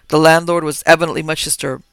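ordinary delivery

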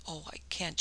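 soft, mildly tremulous refusal; hushed, a touch of distress. A narrator's reading. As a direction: let the refusal falter quietly. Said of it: "she cried tremulously".

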